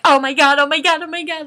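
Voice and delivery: high, loud, repeated squeal of excitement; acted fangirl panic. Fan seeing the band walk into the tent, frantic excitement. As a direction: lose it at the sight of your idols.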